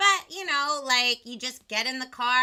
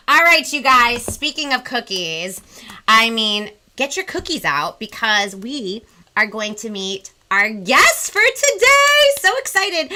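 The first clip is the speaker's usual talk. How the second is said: excited build-up; 12 dB louder than usual. Real, not acted. Hyping the guest's arrival.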